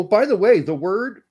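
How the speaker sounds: ordinary talk, nothing marked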